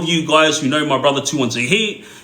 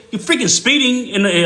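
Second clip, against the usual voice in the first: mock indignant, pitched up; retold angry confrontation; 8 semitones higher than usual. Brother scolding the speeding old lady, mildly indignant. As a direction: play the brother telling her off.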